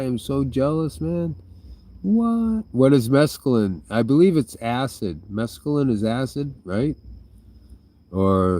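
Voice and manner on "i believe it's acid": ordinary talk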